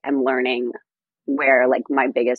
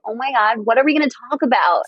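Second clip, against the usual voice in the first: high, fast, panicked disbelief; exasperated; 9 semitones higher, faster than usual. Retold or imitated. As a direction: wail it out in dismay. Retold exasperation on date.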